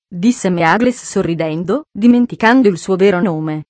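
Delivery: ordinary delivery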